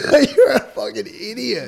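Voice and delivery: loud laughing jab. Real, not acted. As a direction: laugh through the insult. Laughing at friend.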